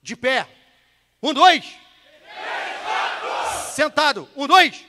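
loud, high orders with punched counts; shouted drill command. Shown as a demonstration. Instructor drills the class to stand and sit, shouted drill command. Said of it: "por isso que eu falei".

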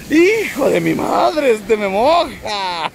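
high, slow yelp as the wave hits; startled outcry. Real, not acted. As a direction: cry out, caught off guard by the splash. Soaked by a sudden wave. (grito de sorpresa al mojarse)

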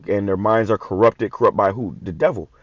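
ordinary delivery

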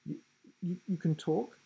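stammering. A narrator's reading. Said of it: "he stammered".

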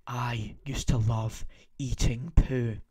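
slow unvoiced whisper, holding back laughter. Shown as a demonstration. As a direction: whisper it, trying not to crack up. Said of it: "help me just try and say this without laughing".

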